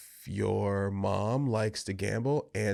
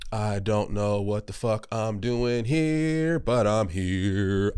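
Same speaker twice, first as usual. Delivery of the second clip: slow emphatic; 4 dB louder, slower than usual. Real, not acted.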